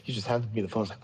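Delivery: ordinary delivery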